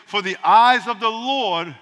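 very slow, weighty verse reading; solemn slowed reading of scripture. Shown as a demonstration. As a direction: draw the verse out word by word. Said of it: "it says".

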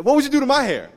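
loud, high mock exclamation; exaggerated jokey outburst; playful joking question. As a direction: pitch it way up to get her laughing. Joking to cheer up student.

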